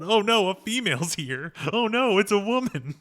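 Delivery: high-pitched pretend panic; hammed-up horror, laughing at the character; exaggerated dread, pitched well up; mock scream. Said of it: "reels back and screams about".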